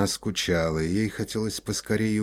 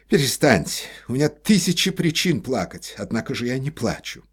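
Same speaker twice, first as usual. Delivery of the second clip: loud and higher, exasperated; irritated scolding; 6 semitones higher, 6 dB louder than usual. A narrator's reading. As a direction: tell her off sharply. Painter scolds crying Olga.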